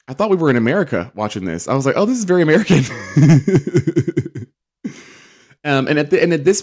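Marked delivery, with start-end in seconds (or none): 1.9-2.8 high, loud mock realization; tickled, raised-voice reaction; amused mock exclamation. Retells own amused reaction, then laughs. 2.9-4.4 high, loud run of giggles; real cackling laugh. Real, not acted. Laughs at own remark.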